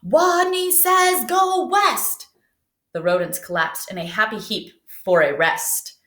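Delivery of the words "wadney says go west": high, slow booming command; strong voice. Acted. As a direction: call it out so strong it hurts your ears. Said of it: "In a voice so strong".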